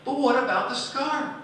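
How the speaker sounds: put-on high, loud kids cutting in; impatient kids. Pupils interrupting the teacher's story, high and loud. Children pleading after class ends.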